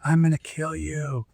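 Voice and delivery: slow, held-back imitation of yelling; imitated distant yell. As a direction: imitate a threat yelled from outside without real volume. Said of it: "heard somebody yelling".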